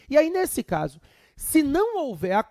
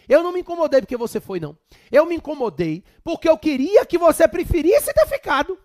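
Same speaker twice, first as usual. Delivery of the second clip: quoting the wife, louder and higher; wife's retort; 6 semitones higher, 7 dB louder than usual. Retold or imitated. Lecturer quoting his wife's surprising complaint, lightly mocking. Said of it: "Ela me soltou a seguinte pérola".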